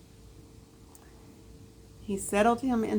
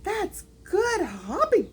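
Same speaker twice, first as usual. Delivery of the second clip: high admiring little hare; a small bunny impressed; 11 semitones higher than usual. A narrator's reading.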